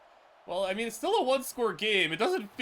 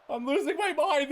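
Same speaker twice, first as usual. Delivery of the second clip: higher, more desperate I'm losing my mind; rising exasperation; 7 semitones higher, 4 dB louder than usual. Real, not acted. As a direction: push the frustration up a notch.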